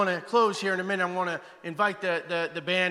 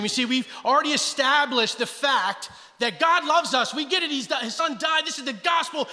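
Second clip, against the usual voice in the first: ordinary delivery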